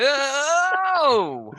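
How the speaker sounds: sudden high startled "Oh!"; startled gasp. Retold or imitated. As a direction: yelp of surprise. Mocking Rubio reaching for water.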